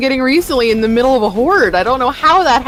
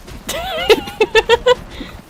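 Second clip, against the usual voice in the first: high-pitched burst of real laughter; laughing. Real, not acted. Friend amused by a teammate's guess, laughing. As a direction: burst out giggling. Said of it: "Laughs at everything".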